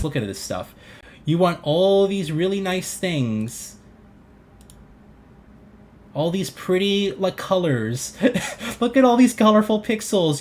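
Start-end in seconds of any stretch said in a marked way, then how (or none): 1.2-10.4 mocking coo. Real, not acted. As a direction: tease fans who crave shiny graphics. Mocking fans' desires.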